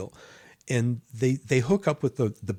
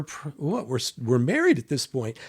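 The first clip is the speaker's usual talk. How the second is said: normal talking voice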